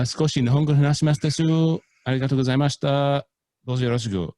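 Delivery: hammed-up Japanese accent; mock Japanese. Shown as a demonstration. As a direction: perform the Japanese with gusto. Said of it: "international pronunciations".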